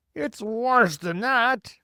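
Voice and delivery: high, loud, drawn-out cry; alarmed cry. A narrator's reading. Police chief topping the fire chief's fear, crying out. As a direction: cry it out in slow horror. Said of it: "cried the chief of police".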